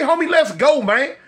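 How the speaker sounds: rising pitch, fast and exasperated; begging his friend to leave, high and fast. Retold or imitated. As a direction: plead to leave, voice climbing high and quick. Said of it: "I say".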